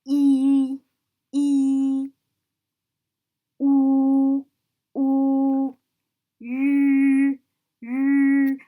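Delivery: loud, level, sustained "E" and "u"; teacher modelling pure vowels; drawn-out vowel demo. Shown as a demonstration. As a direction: hold each vowel "E", "u" long and steady. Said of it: "So take note".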